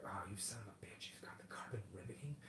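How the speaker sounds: hushed envious whisper, no voice; awed whisper. Retold or imitated. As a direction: breathe it out in jealous awe. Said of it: "And I'll be like".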